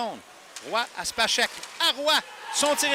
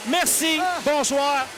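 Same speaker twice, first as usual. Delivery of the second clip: ordinary talk, nothing marked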